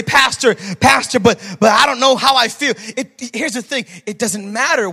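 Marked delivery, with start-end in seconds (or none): 0.0-2.7 higher, louder imagined congregant objecting; protesting church member. Acted. Church member objecting to the pastor, worked-up protest. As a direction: plead loudly with the pastor, pitched up.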